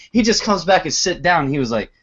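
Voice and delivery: usual speaking voice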